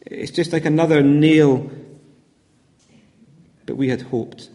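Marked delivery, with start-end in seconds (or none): 3.7-4.5 hopeless sigh. Acted. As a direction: lower and softer, deflated. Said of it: "the sense of hopelessness that they feel".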